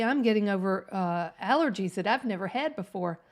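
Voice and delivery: ordinary delivery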